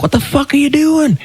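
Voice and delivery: high, squealing mock outrage from the driver; imitating outraged driver. Driver yelling at the cyclist who smashed his window, high mock outrage. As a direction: play the startled driver shrieking in disbelief.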